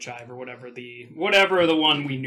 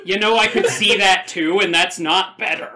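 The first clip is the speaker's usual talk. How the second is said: laughing; 4 semitones higher, 8 dB louder than usual. Real, not acted. Laughing after the reveal.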